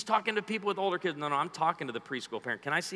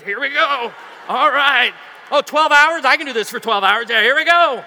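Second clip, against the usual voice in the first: high, loud cheery parent; hammed-up parent psyching up; strapping kids in, mock chirpy; mock eager parent; 9 semitones higher, 14 dB louder than usual. Voicing modern parent on trip.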